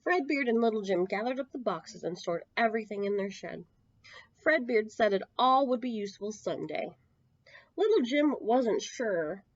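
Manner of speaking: usual speaking voice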